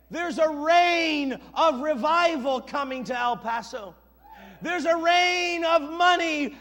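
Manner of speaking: loud, high proclamation building to a shout; ringing revival-preacher cry; full-force preaching. Acted. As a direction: declare it to the congregation, climbing to a yell.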